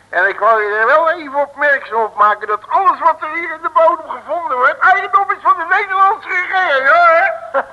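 self-important boast building up; triumphant. Acted. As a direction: puff yourself up officially. Proudly asserting government property.

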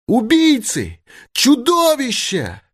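very high, drawn-out scream of accusation; woman's cry. A narrator's reading. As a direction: cry out at the killers, stretching the last word. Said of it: "кричала она". (отчаянный протяжный женский крик)

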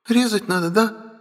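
high, plaintive little-sister voice. A narrator's reading. Sister asks whether she must cut, high and plaintive. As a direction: ask pitifully in a high voice. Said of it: "жалобно спросила Ая".

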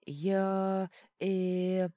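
drawn-out, level "I... er"; mock-profound hesitation. A narrator's reading. Dishevelled man collecting himself, ponderously hesitant. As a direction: stretch each sound out, held on one note. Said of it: "глубокомысленно заметил он".

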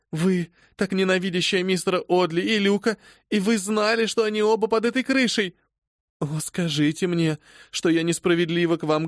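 high, rising anguished begging; desperate accusing plea. Acted. Phoebe desperately begging her mistress to say she is wrong. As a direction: beg her to deny the arson.

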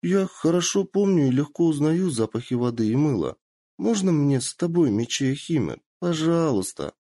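put-on high eager girlish plea; pleading girl voice. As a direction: beg sweetly like a young girl. Kitsune pleading to join.

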